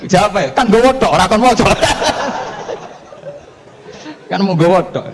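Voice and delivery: high, loud words through laughter; can barely get it out for laughing. Acted. Preacher cracking up retelling Umar's scolding.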